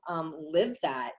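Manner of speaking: ordinary talk, nothing marked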